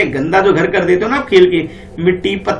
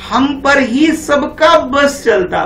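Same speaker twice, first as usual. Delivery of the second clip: slow, higher sing-song line; poem recitation; 6 semitones higher, much slower than usual. Shown as a demonstration. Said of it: "आगे देखो क्या बोला जाता है".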